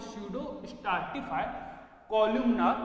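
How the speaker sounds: slow, spaced-out term; slow dictation. Shown as a demonstration. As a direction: read it out slowly for writing. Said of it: "क्या बोलते हैं?".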